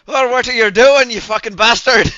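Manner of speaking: put-on loud, high drunken bellow; angry shout. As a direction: yell it like a furious neighbour. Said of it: "you just hear vaguely through the floorboards".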